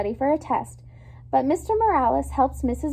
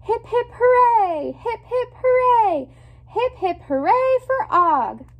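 high, loud, slowed hip hip hooray; group cheer; 10 semitones higher, 6 dB louder than usual. A narrator's reading. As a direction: cheer like a whole classroom. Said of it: "the whole class cries".

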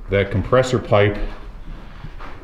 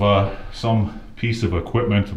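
normal talking voice

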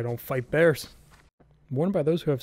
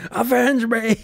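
higher, louder dramatic dying cry; Willem Dafoe; 9 semitones higher, 6 dB louder, slower than usual. Acted. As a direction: shout it like a dying hero. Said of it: "I was gonna do the Willem Dafoe".

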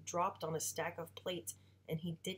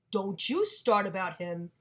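slow, loud angry father; angry warning; 2 semitones higher, 9 dB louder than usual. Acted. Father warning mother not to start, angry. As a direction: cut her off with a slow growl.

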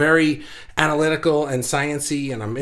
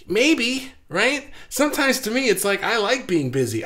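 high, bright agreement; excited; 7 semitones higher than usual. Real, not acted. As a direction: lift the voice eagerly. Enthusiastic agreement with comment.

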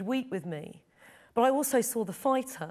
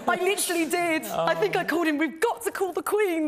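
higher, louder, laughing through the words; 7 semitones higher, 5 dB louder than usual. Real, not acted. Woman laughing as she retells phoning Danny. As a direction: laugh while insisting it's true.

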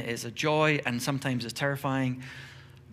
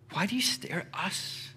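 whispered question; 6 semitones higher, 3 dB quieter than usual. Pretend. Peter addresses the crowd.

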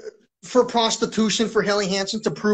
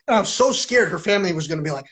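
ordinary talk, nothing marked

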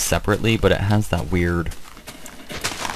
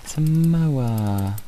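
slow, higher British-accented moa; mock British accent, drawn out; 6 semitones higher, much slower than usual. Shown as a demonstration. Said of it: "I say moa in a British accent every time".

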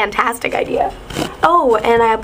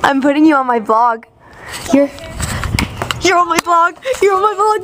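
loud teasing, climbing higher; 7 semitones higher, 4 dB louder than usual. Real, not acted. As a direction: tease them, getting higher.

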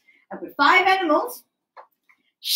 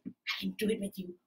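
ordinary delivery

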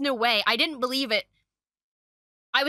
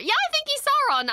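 excited realization; 14 semitones higher than usual. Retold or imitated. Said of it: "then I was like".

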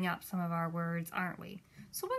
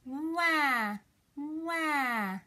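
drawn-out mock baby wail; crying baby; 4 semitones higher than usual. Shown as a demonstration. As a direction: imitate a baby's cry, raised and falling. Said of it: "you sound like a good crying baby".

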